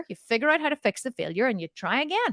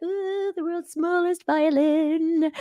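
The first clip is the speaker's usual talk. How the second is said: slow, drawn-out teasing voice; mocking husband; 6 semitones higher, much slower than usual. Husband teasing her about her complaining: slow mock-sympathy. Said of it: "he's like".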